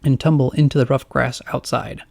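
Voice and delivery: ordinary delivery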